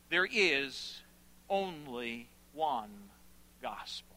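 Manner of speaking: "only one" slow and low, then pause; grave, spaced-out emphasis. Acted. Preacher closing the reading, "only one gospel" slow and grave. Closes reading with weighty statement.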